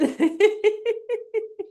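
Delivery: high laughing run; exercise laughter. Shown as a demonstration. Keeping the lawn mowing laugh going, high laughter.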